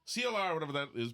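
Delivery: ordinary delivery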